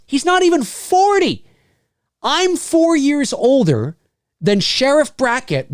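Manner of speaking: high-pitched disbelief, slowing down; incredulous. Real, not acted. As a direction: blurt it high, then stretch out the realization. Shocked at actor's real age.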